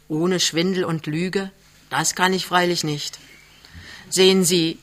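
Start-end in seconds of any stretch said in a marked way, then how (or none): none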